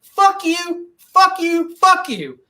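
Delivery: high, sharp fuck you three times; mock-furious curse at the unvaccinated; angry repeated curse. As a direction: hurl it at them. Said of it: "But now I'm like".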